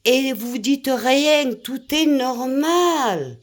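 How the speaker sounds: indignant scolding. Real, not acted. Scolding French viewers for accepting everything, slow and indignant. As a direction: drawl it out, outraged at their silence.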